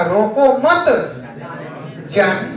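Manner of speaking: group मत with रोको, not with जाने; pause after मत. Shown as a demonstration. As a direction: run रोको into मत, break, then जाने. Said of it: "और लोगों ने क्या समझा".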